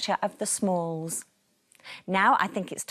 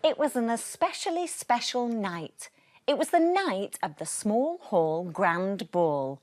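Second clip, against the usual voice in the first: lively storybook reading with voiced characters; animated read-aloud for children; bedtime story narration; 3 semitones higher, 4 dB louder than usual. A narrator's reading. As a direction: read it like a bedtime book to little ones. Reading picture book to children.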